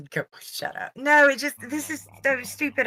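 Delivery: ordinary delivery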